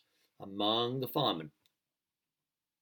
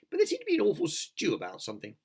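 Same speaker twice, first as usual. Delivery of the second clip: centipede voice; 11 semitones higher, 4 dB louder than usual. Acted. Centipede voiced in read story.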